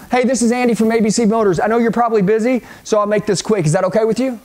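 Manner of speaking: fast, run-together sales opener; brisk cold-call pitch, no gaps; quick script delivery. Shown as a demonstration. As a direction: roll straight through it without pausing. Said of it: "you roll with this quick".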